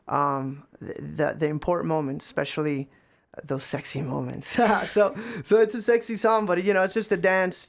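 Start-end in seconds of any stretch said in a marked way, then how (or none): 4.6-7.7 laughing through the words, higher and louder. Real, not acted. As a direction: giggle it out after the joke. Laughs after sexy moments.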